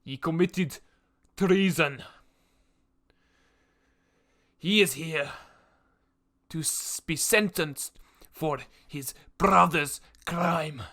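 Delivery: slow, loud formal proclamation; executioner's guard booming the charge; guard's announcement. Acted. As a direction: declare the charge to the whole square. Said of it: "the man goes".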